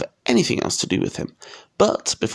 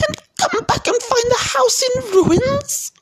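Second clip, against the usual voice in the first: very high, slow snarl; outraged aunt, drawn out and screechy; 3 dB louder than usual. A narrator's reading. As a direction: snarl it in a high-pitched woman's voice. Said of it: "she snarled".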